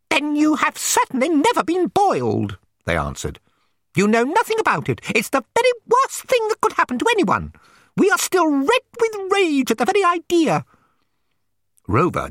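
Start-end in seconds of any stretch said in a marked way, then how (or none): 0.1-2.6 very high, squeaky shrimp voice; indignant shrimps. A narrator's reading. Shrimps challenge the complaining toy dog, indignant. 3.9-10.6 very high, squeaky shrimp in a huff; outraged shrimps. A narrator's reading. Shrimps scold the dog about boiling, outraged.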